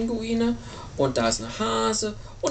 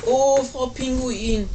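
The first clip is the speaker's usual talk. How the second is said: high, slow, drawn-out call of a little bird; little bird character; 3 semitones higher, 5 dB louder, slower than usual. Acted. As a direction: call out high and louder, stretching the words. Little bird hails penguin in story.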